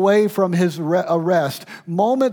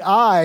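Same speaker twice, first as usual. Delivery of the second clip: "I" said higher and louder than the rest; stress on I; 4 semitones higher, 6 dB louder than usual. Shown as a demonstration. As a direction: lean hard on "I". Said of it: "in that is God's voice".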